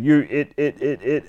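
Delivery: malfunctioning robot voice, looping; damaged speech sensor. Acted. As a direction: stick on a syllable like a broken robot. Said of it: "your speech sensor's damaged".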